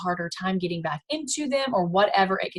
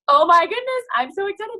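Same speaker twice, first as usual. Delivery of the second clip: squealing high delight; high, thrilled reply to a customer; excited gush; 10 semitones higher, 4 dB louder than usual. Pretend. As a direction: burst out with excitement. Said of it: "you're gonna come back and you're gonna say".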